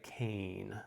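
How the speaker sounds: "Cain" as one syllable, rhyming with "rain"; diphthong. Shown as a demonstration. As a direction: glide the vowels of "Cain" together. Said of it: "I made this a diphthong".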